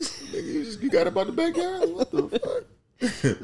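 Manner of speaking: high, laughing incredulous outburst; laughing disbelief. Real, not acted. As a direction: crack up while asking.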